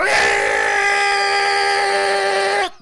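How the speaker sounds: long, high, blaring horn shriek; sustained full-volume honk; air horn imitation.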